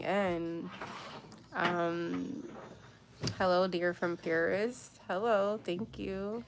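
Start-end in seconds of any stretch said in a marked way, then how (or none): none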